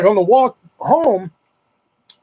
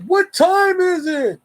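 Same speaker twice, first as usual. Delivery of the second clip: high, slow call across a distance; 13 semitones higher, much slower than usual. Retold or imitated. Friend calls to the neighbor across the street, high slow call. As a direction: drag it out to carry over the road.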